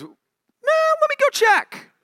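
very high, slow, drawn-out nonchalance; casual brush-off. Retold or imitated. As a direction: toss it off lazily and unbothered. Jonathan casually brushes off David.

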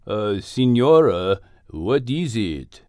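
low, slow Spanish bull; bull Don Pedro. Acted. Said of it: "asked Don Pedro".

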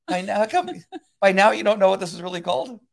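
fed-up spouse; mock exasperation. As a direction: nag them in disbelief. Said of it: "And now it's like".

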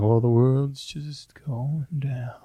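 whispered doom, slow and gloomy. Retold or imitated. Whispering doom while telling the group about disposition. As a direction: breathe it out like the world is ending. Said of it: "I'm like".

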